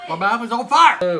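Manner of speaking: shout. Real, not acted. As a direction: yell out from the heat. Eating hot pepper chocolate.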